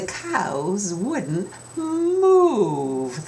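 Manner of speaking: slow, drawn-out move; stubborn slow delivery for the kids to join; stretched out. Shown as a demonstration. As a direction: drag the word move out long. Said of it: "That's the word I want you to help me with".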